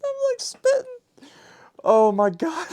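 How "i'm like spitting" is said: high, squealing laughed-out words; helpless laughter. Real, not acted. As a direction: crack up while repeating it. Listener cracking up at the joke.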